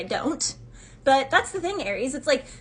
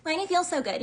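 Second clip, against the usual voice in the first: mock indignant question; 6 semitones higher than usual. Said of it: "people out here are like".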